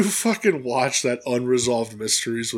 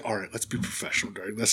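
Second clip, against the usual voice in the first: hushed, quick, much softer aside; imitating a backstage whisper, fast and low; retold whisper; 6 semitones lower, 12 dB quieter, faster than usual. Host retelling a whispered warning to Mariah, hushed. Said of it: "R J kinda whispered to him".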